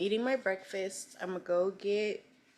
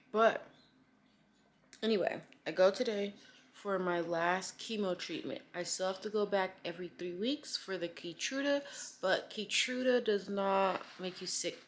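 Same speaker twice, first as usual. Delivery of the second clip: ordinary talk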